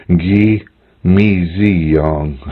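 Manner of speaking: slow "Gi mi zi yang" with a z in "mi zi"; the slip "zi" sounded slowly in "Gi mi zi yang"; mispronounced word. Shown as a demonstration. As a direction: say "mi zi" with a buzzing z, drawn out. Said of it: "mispronouncing this word".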